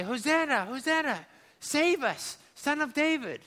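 crowd acclaim. Acted. Crowd acclaims Jesus, calling out.

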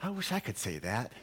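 very soft, wistful aside; quiet comic confession after an outburst; hushed inner thought. Retold or imitated. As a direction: think it aloud under your breath. His inner thought after son's yell.

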